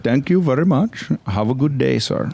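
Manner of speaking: put-on polite Indian accent, lightly singsong; a courteous Indian delivery guy. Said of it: "They're like".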